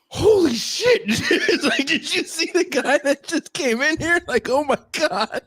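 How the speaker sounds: high, laughing disbelief; stunned excitement. Retold or imitated. Bandmate amazed by the visitor, high laughing disbelief. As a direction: gasp it out through laughter.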